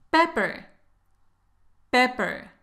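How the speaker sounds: ordinary delivery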